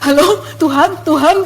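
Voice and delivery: tentative calling, tune lifting at the end; naive. Retold or imitated. Newly hearing God, calling back naively. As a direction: call out as if unsure anyone answers. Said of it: "masih polos ya kan".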